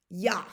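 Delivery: Ja with a clear German y-sound; Ja, like German yah. Shown as a demonstration. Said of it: "this is how you say yes in German".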